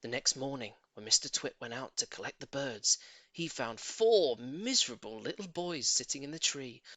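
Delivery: usual speaking voice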